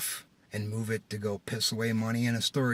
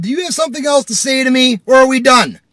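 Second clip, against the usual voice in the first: very loud, high shout; furious challenge; 13 semitones higher, 18 dB louder than usual. Retold or imitated. Driver to man pulling away, furious shout. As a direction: yell it as a final confrontation.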